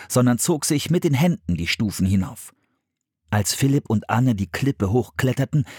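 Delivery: ordinary delivery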